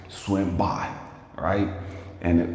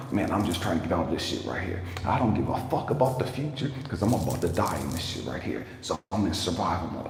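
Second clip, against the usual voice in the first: normal talking voice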